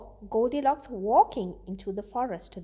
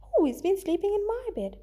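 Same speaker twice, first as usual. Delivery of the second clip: higher mother bear voice; Mama Bear; 7 semitones higher, faster than usual. Acted. Teacher voicing Mama Bear for her students, higher. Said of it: "Mama Bear says".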